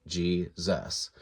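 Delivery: "Jesus" split slowly as JEE-zus; "Jesus" with a hard English J, syllable by syllable; English pronunciation. Shown as a demonstration. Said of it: "We started calling him".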